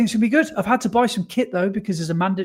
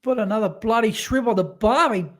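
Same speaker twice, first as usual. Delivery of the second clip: hammed-up Australian accent, higher pitched; 3 semitones higher than usual. Asked by co-host for the Australian accent, hammy Australian. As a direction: play a cartoon Aussie bloke in an Australian accent. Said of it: "do you wanna do the Australian, um, accent".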